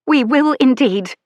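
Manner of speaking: fierce as a wolf guarding her pup; fierce lady. Acted. Lady's final retort.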